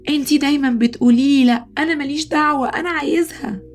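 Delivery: high, fast tantrum cry; acted childish scream. Boy screaming at his mother for a toy, acted high tantrum. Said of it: "فجأة صرخ وقال".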